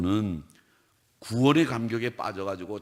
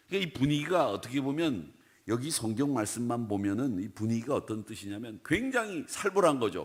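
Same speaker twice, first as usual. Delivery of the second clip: ordinary delivery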